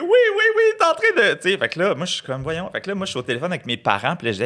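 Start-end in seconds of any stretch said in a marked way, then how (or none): 0.0-1.3 very high overwrought cries; mock hysterical yes yes yes; frantic mock. Landlady opens door to police. (hystérie jouée, voix très aiguë)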